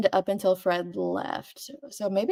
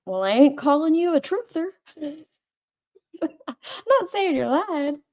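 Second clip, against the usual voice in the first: mock the movie line, pitched up; movie character quote; 7 semitones higher than usual. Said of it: "where he's like".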